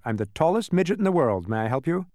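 lightly voiced self-important man; proud tall midget. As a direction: introduce yourself with a touch of grandeur. Man introduces himself as midget.

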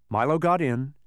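ordinary delivery, nothing marked

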